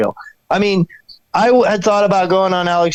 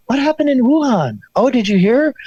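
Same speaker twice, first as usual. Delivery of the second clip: mock gossipy alarm; 8 semitones higher than usual. Mimicking alarmed gossip about Wuhan.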